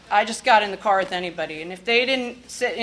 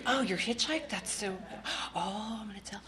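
tattletale cousin; 8 dB quieter than usual. Retold or imitated.

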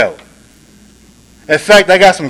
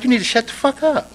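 inner angry voice; 7 semitones higher, 5 dB quieter than usual. Retold or imitated. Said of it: "That's what the defective side wanted to say".

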